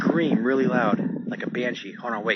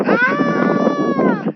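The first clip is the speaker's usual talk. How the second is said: high wailing mock scream; imitating a banshee scream; 7 dB louder than usual. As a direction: copy the eerie scream with a sharp high cry. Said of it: "It sounds like".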